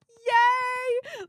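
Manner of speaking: high, drawn-out cheer. Real, not acted. Thanking a supporter live on stream, cheering. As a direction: let out a long high "yay".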